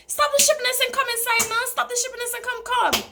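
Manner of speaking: mock the dad calling her in from the shore; imitating her dad. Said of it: "So he's like".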